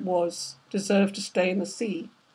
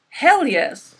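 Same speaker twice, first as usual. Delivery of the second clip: eager agreement; 6 semitones higher, 5 dB louder than usual. A narrator's reading. Father excited agreement.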